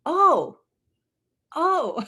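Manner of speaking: high, loud "oh" of discovery; re-enacted eureka moment; sudden realization. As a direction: exclaim as if it just hit you. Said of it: "And then I'll go".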